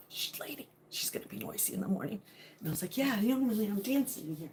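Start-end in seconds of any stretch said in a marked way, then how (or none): 0.1-2.2 mock hushed whisper of the kids; mimicking kids. Mom imitating her son shushing her, whispered.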